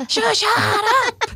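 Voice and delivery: high, strained re-enacted snap at a child; exasperated shushing. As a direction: shoot up in pitch to hush the kid. Snapping at child before phone call.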